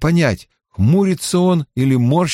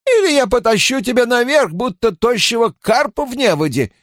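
higher-pitched scolding woman's voice; pitched-up, bossy matron; stern healer woman; 9 semitones higher than usual. Acted. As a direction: lay down threats as a no-nonsense healer.

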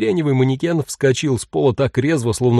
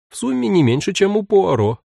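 higher, teasing punchline; a playful raised voice; playful quip; 8 semitones higher than usual. A narrator's reading. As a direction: tease her mother lightly. Playful joke about Poirot.

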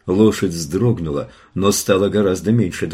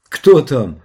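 high, loud, startled question; shouted challenge; 5 semitones higher, 7 dB louder than usual. A narrator's reading. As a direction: call out sharply into the unknown. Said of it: "закричала она". (окрик в темноту)

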